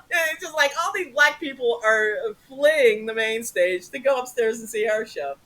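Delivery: ordinary talk, nothing marked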